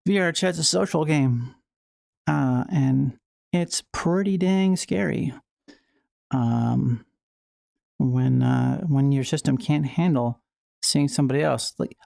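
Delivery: ordinary delivery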